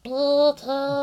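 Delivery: high squeaky puppet shout; goofy muffled sock puppet. Pretend. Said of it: "puppet voice".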